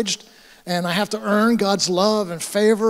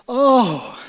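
higher, drawn-out imitated gasp of wonder; overcome with wonder; 5 semitones higher, 4 dB louder than usual. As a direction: act out her joyful revelation. Retold convert opening eyes after praying.

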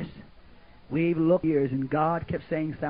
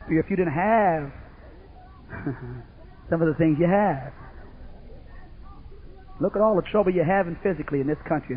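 ordinary talk, nothing marked

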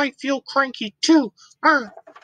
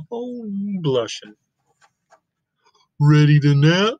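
Gerald voice; 8 semitones lower than usual. Acted. Gerald low voice.

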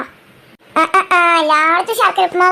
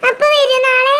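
put-on high, eager child's question; excited child; 8 semitones higher than usual. Child checking father comes tomorrow, excited. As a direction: ask high like a hopeful little kid.

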